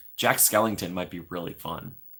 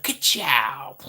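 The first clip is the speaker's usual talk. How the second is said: imitating Lightning McQueen; 6 semitones higher, 4 dB louder than usual. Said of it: "he's like".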